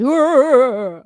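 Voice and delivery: a burst of loud laughter. Real, not acted. Mocking the gossipers, laughing out loud. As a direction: laugh it off loudly.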